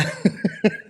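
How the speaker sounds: high, breath-led giggle; short real laugh trailing on; amused laugh. Real, not acted. Reacting to the date's excuse, amused laugh.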